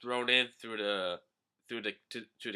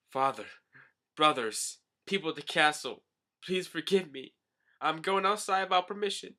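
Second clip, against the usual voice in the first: uppity princess; 7 semitones higher, 4 dB louder than usual. Retold or imitated. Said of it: "she's still acting all uppity and princess-like".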